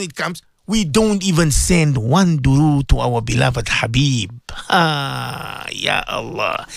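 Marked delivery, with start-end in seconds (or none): 4.7-6.7 low, hushed sigh to God; sorrowful sigh. Real, not acted. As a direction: sigh it out softly and deep in pitch, lamenting. Lamenting neglect of the beloved Habib.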